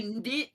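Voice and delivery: "ndit" with a short clipped vowel; Australian "not". Shown as a demonstration. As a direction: say "ndit" like an Aussie. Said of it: "You would say".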